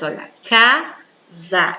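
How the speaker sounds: casa said slowly as ca-sa, each syllable apart; slow syllable split. Shown as a demonstration. Teacher showing pupils casa has two syllables, slowly. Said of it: "Eu pronunciei duas vezes".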